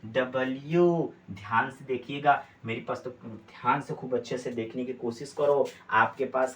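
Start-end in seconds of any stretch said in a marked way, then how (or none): 0.1-1.1 डब्ल्यू drawn out slowly as da-bal-yoo; slow, full "double-you" on डब्ल्यू; careful letter-name. Shown as a demonstration. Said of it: "क्या बोलेंगे".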